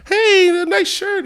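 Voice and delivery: reporter voice. Retold or imitated. Reporter interviewing kid.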